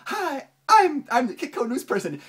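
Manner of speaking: high, bright mock anchor voice; chirpy professional TV presenter; perky news host. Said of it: "she's trying to act like, professional".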